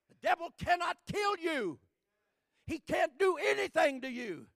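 loud, high-pitched preaching; fiery pulpit preaching; preaching at full force. Acted. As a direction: preach it hard to the congregation.

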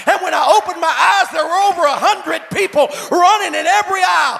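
loud, high, driving preacher's climax; preaching at full force. Acted. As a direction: build the crowd up to a roar.

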